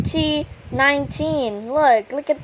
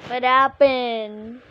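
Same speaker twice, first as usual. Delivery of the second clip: ordinary delivery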